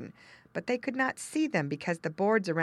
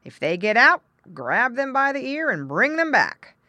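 loud, higher-pitched farmer's order; firm command; 8 semitones higher, 8 dB louder than usual. Acted. Farmer telling dog to guard pigs, commanding. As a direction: give the dog forceful orders.

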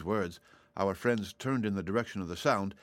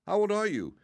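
much higher, louder, wide-eyed question; fascinated boy; 13 semitones higher, 4 dB louder than usual. A narrator's reading. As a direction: ask it spellbound. Zeb asks, fascinated.

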